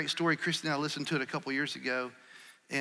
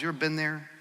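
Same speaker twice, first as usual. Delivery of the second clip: ordinary talk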